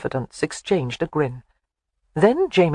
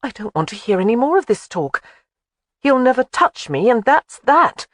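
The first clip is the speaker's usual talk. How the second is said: put-on higher young woman's voice, firm; stubborn young lady refusing; Jamie defiant; 8 semitones higher, 4 dB louder than usual. A narrator's reading.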